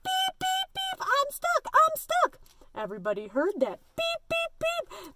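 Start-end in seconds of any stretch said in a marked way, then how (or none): 0.0-2.4 tiny high voice, beeping in alarm; Little Blue Truck crying out. A narrator's reading. Truck stuck tight in mud, high squeaky cry. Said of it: "cried the Little Blue Truck". 3.9-5.1 high-pitched beeps; little truck horn. A narrator's reading. Animals hear the stuck truck, small high beeps.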